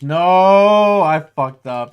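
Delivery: loud, high dismayed cry; frustrated outburst. Real, not acted. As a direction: yell at your own mistake. Botched the nickname.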